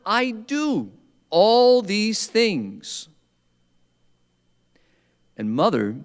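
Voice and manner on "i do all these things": much higher, very slow, pleading; protesting priest. Retold or imitated. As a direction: draw it out high as if defending yourself. Priest insisting he does enough.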